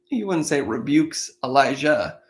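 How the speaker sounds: ordinary delivery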